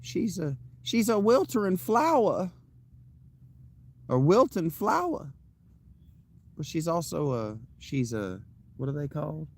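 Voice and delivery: high-pitched Southern Belle lilt; Southern Belle accent. Shown as a demonstration. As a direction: play a wilting Southern flower, high-voiced. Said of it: "speaks with a Southern Belle accent".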